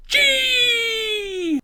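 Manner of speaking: high, piercingly loud held cry; drawn-out shout. Pretend. Ranker inventing a category just for him, long high shout. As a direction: scream the letter and hold it.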